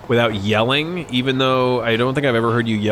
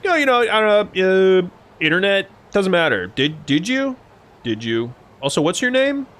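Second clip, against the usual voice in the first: mock evasive dodge; 7 semitones higher, 3 dB louder than usual. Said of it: "You're like".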